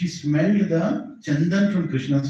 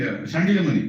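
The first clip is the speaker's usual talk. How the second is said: normal talking voice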